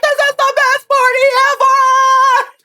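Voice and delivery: very high, loud party yell; shrieking reenactment of party euphoria; retold ecstatic scream. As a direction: scream it as an overjoyed fan. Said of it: "we're screaming in each other's face".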